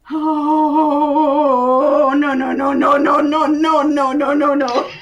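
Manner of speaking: long low oh into a run of noes; low, unbroken stream of dread; alarmed backing away. Acted. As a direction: chant no while backing slowly away. Character backs away from something.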